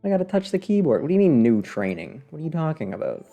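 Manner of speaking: ordinary delivery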